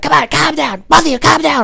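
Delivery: high, loud mock shriek; frantic girlfriend. Girlfriend trying to calm both men, imitated high-pitched yelling. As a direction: impersonate a girlfriend breaking up a fight. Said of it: "his girlfriend ran out".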